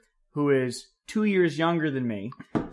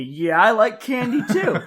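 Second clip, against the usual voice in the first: higher, slower, laughing sister voice; mock sister; 5 semitones higher, 4 dB louder than usual. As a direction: drawl it out happily through a laugh. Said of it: "She goes".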